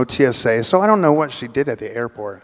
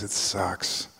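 lower, much softer, muttered; quiet, glum muttered answer; 6 semitones lower, 12 dB quieter than usual. Retold or imitated. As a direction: grumble it under your breath. Lonely woman's curt answer.

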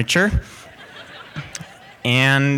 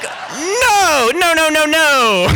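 high, loud mock-shouted protest; retold horrified refusal; 13 semitones higher, 3 dB louder, much slower than usual. Reacting to housemate offering intruder a ride, high horrified shout. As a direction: cry out the refusal, pitched way up.

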